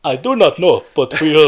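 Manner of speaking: put-on emphatic African accent; playfully hammed-up African accent. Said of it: "Let's do the rest of the podcast African".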